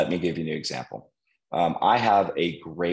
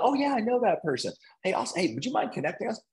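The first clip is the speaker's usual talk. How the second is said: much higher, quick casual voice; breezy ask; faster than usual. Retold or imitated. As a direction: slide from yes into the favor. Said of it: "And I'm like".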